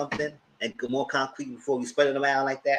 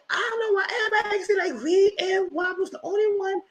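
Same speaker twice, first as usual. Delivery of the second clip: high-pitched mocking quote voice; put-on high voice, far above normal; Robin. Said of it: "Robin said".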